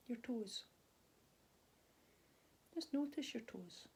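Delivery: calm, unhurried body scan guidance with long pauses; soothing mindfulness teacher voice; guided meditation. Real, not acted. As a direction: gently guide them through their body, leaving space after each phrase.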